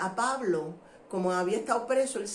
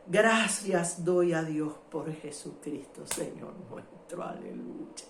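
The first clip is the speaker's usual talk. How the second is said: slow thanks fading into a breathless whisper; overcome thanksgiving; 6 semitones lower, slower than usual. Real, not acted. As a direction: start full, then let the voice drop away to almost nothing. Overcome while reading thanks to God.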